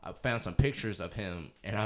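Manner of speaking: usual speaking voice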